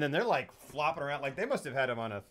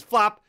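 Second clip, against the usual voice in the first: loud, high splat word; sudden loud spoken flop; imitated flop; 6 semitones higher, 12 dB louder than usual. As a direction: punch it out as a sound effect. Imitating her flopping into water.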